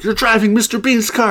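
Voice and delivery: very high, loud mock outrage; hammed-up incredulous shout; shouted accusation. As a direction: yell it like a scandalized hero. Acted accusation in pitched movie scene.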